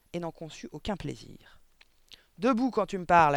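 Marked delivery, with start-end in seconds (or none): 2.4-3.3 louder, barking command; barked order. Acted. Headmistress ordering the girl to stand, barked. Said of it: "aboya-t-elle".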